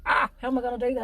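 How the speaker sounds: quick, mildly flustered worry; mock panic. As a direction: blurt a hammed-up overwhelmed thought. Voicing an overloaded musician's worry.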